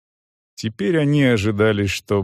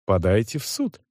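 melancholic reply; 4 dB quieter than usual. A narrator's reading. Manager sends complainer to court, unbothered. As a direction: dismiss him with quiet melancholy. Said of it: "меланхолично отвечал Крутец".

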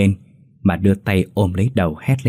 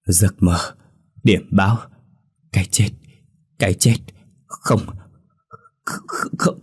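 frightened mumbling. Acted. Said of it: "nói lảm nhảm trong miệng".

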